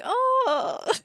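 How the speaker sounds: high, loud mock-dismayed "oh"; hammed-up dismay; sad panda. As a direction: wail a drawn-out, playful oh of disappointment. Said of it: "Then I'm just like".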